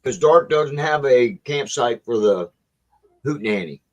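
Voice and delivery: usual speaking voice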